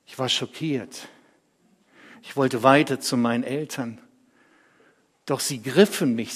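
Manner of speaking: ordinary delivery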